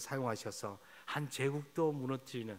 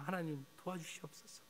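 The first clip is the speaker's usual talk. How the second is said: softly, pleading to God; a quiet lament, almost breaking; hushed plea; 2 semitones higher, 10 dB quieter than usual. Pretend. Preacher voicing a prayer for the land, hushed and pleading. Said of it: "탄식하기를 원합니다".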